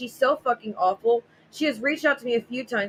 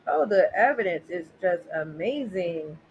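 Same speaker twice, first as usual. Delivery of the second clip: sarcastic; 4 semitones lower than usual. Acted. Sarcastic aside on posted evidence.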